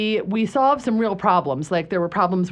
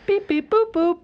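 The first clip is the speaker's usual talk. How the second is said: high, beeping robot; 8 semitones higher than usual. Pretend. Guest playing her future self on radio, robot voice. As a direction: beep and boop like a toy robot. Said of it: "That's how robot [name] will talk".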